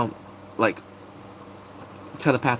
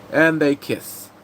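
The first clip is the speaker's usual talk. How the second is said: usual speaking voice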